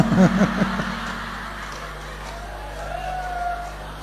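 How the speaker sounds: a high squealing burst of laughter. Real, not acted. Preacher laughs high and long at his wife's imperfect-husband prayer. Audience-style laugh at punchline.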